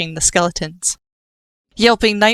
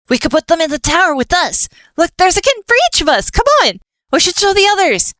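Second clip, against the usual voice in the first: much higher, fast eager talk; excited chatter; 12 semitones higher, 4 dB louder than usual. Acted. Killer eagerly pitches keeping the kittens to his boss, high and quick. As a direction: rush it out, thrilled.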